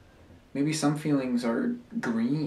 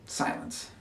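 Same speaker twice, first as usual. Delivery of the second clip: very soft, whispered SILENCE; hushed whisper; 9 dB quieter than usual. Acted. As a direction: let the word drop to a whisper. The word silence, hushed after pause.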